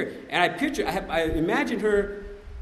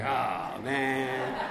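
low, drawn-out reluctant groan; retold dismayed groan; 4 semitones lower, 4 dB quieter than usual. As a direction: act out sinking dread at being asked. Dreads the question he hates.

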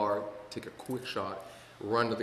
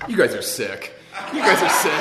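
louder, higher, laughing jab; laughing tease; 6 semitones higher, 12 dB louder than usual. Real, not acted. Speaker teasing the laughing audience, laughing. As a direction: tease the crowd through laughter.

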